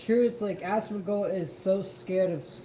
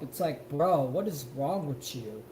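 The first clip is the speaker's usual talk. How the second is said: ordinary talk, nothing marked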